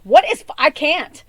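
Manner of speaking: loud, high shocked cry; loud disbelieving outcry; shocked outburst. Retold or imitated. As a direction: blurt it out in disbelief. Reacting to a stabbing headline.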